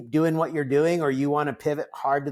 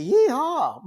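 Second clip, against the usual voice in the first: high, loud whoop of yeehaw; joyful yeehaw; 11 semitones higher, 4 dB louder than usual. Shown as a demonstration. Surfer cheering mid-ride, high loud yeehaw. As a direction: let out a cowboy whoop. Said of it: "in the middle of a surf, I wanna say".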